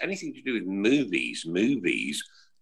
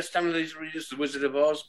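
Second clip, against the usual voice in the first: ordinary talk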